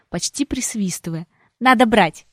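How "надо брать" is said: louder, emphatic sister; emphatic urging. A narrator's reading. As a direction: urge her firmly.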